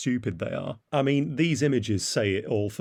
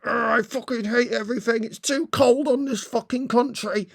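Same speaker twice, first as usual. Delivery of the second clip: much higher mock complaining voice; mocking protester whine; 14 semitones higher, 4 dB louder than usual. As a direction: sneer at them by whining like a spoiled kid. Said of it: "kind of".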